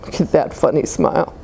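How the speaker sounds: plain reading voice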